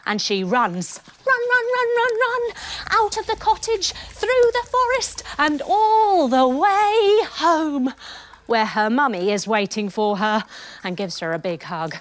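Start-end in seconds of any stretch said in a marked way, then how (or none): 1.2-7.9 breathless chase. A narrator's reading. Goldilocks flees the bears.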